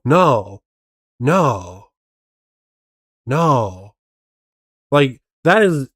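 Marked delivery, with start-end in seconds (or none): 0.0-3.9 mock unpanicked "no", evenly spaced; unpanicked pleading. Mimicking the man in the video, unpanicked pleading. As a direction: protest with no real alarm, pausing between words. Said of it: "that is not a panic tone".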